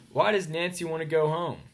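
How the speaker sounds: ordinary delivery, nothing marked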